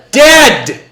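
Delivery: high, loud angry yell; furious mom. Retold or imitated. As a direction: yell it like an enraged mother. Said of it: "my mom go is".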